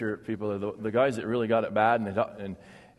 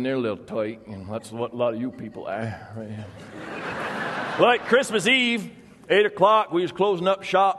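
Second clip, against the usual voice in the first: put-on storyteller Vermont accent, unhurried and dry; rural Vermont logger accent. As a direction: tell it like an old Vermont logger spinning a yarn. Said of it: "you've got the accent down".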